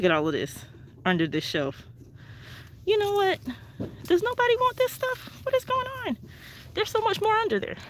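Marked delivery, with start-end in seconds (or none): 2.9-7.8 much higher, incredulous; can't believe nobody took this; amazed. Real, not acted. To viewers, amazed at the stuff under the shelf.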